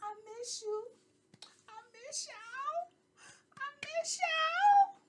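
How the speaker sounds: high, slow fake sob; mock crying. Host whining to viewers she missed them, fake sobbing. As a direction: wail it like a hammed-up weepy miss you. Vlogger greeting viewers after absence.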